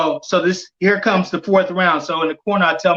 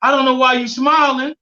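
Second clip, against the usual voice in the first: raised, complaining challenge; retold yell; 8 semitones higher than usual. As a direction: imitate an annoyed fighter calling out. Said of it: "Tank at this point yells out".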